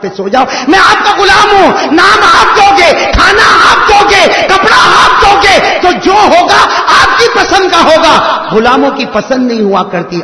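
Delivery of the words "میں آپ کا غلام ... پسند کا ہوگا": loud, high, slow pleading cry; shouting slave. Acted. Slave begging his master not to free him, loud slow cry.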